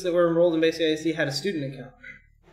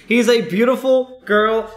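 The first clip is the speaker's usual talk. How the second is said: mock gushing; 4 semitones higher, 6 dB louder, slower than usual. Real, not acted. Teasing a friend about his princess hair, slow and loud. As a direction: ham up the praise, stretched out and loud.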